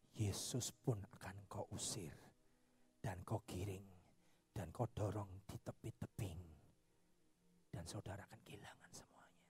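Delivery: deep hushed warning; whispered warning. Real, not acted. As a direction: warn them in a hush. Preacher's solemn warning.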